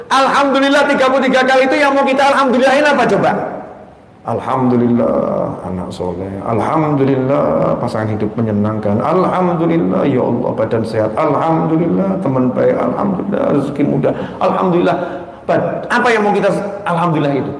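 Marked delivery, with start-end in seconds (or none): 4.3-15.0 mindful thanks. Shown as a demonstration. Preacher shows the congregation how to give thanks mindfully. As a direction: pause and mean every Alhamdulillah. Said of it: "demonstrating mindful thanks".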